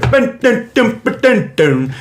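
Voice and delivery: high plucked "dun" syllables; mock banjo riff, dropping at the end; banjo imitation. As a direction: twang out nonsense notes like a banjo. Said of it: "best banjo impression I've ever heard".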